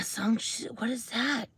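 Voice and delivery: puzzled whisper, almost unheard; whispered aside. Real, not acted. Host puzzling over a viewer name, whispered aside. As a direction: whisper it to yourself while reading. Muttering while reading a name.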